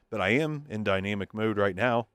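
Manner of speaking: usual speaking voice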